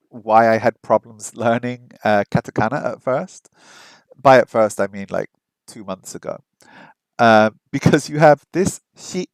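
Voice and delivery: normal talking voice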